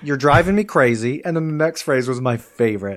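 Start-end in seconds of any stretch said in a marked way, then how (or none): 0.0-1.2 louder, higher mock exasperation; fed up with the student's questions; exasperated teacher. Teacher exasperated with her pestering student. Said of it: "She's like".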